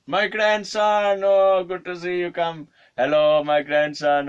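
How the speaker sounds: high, loud, stretched-out greeting; delighted old man. Retold or imitated. Old man welcoming his supposed grandson, delighted. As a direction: gush in a hammed-up old man's voice.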